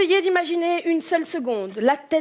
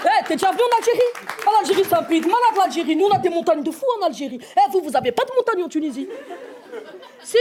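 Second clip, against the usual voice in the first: excited Algerian fiancé; 5 semitones higher than usual. Acted. Algerian fiancé welcomes friend at the border, boisterous excitement. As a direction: hail a friend with brash, overflowing enthusiasm.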